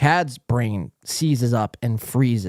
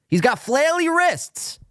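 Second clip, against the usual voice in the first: high, slow mocking tone; much higher, drawn-out mockery; mock Chad voice; 13 semitones higher, slower than usual. Host mocking Chad, high slow mock voice.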